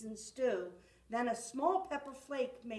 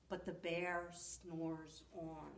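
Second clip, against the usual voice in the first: softer, slow sleepy refrain; soft lullaby hush on the refrain; 5 semitones lower, 9 dB quieter, slower than usual. A narrator's reading. Sleepy refrain of snoring bear.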